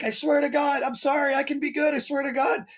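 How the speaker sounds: high, hammed-up begging; pleading recruit. Recruit begging the instructor for another chance, high hammed-up pleading. Shaky begging Pines to stay.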